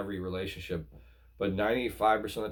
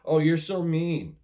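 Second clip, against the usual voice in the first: high, loud, put-upon mock outrage; sarcastic impression of a manipulative partner; mocking whiny partner; 6 semitones higher, 4 dB louder than usual. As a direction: whine it back like the wounded party. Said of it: "and they're like".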